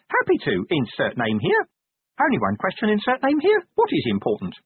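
high-pitched little imp, willing to serve; high, officious imp voice; eager imp. Acted. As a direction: answer as an eager small creature.

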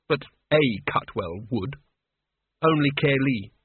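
ordinary delivery, nothing marked

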